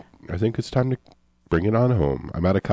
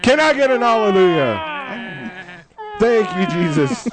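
high revival call, drawn out; mock preaching; 12 semitones higher, 3 dB louder, much slower than usual. Preacher closing the carrot sermon, mock revival fervour. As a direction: whip up the congregation for a hallelujah.

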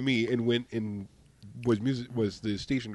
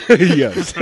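loud laughing yes; loud laughter; 8 semitones higher, 17 dB louder than usual. Real, not acted. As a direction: laugh out loudly through it. Bursts out laughing.

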